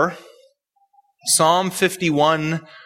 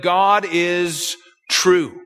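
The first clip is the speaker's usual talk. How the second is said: slow, each word of God is true weighted; emphatic declaration; much slower than usual. Shown as a demonstration. A teacher declaring to his class that God is true, slow and emphatic. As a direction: slowly lean on God is true as a firm declaration. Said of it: "an emphatic way to say that".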